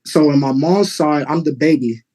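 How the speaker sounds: normal talking voice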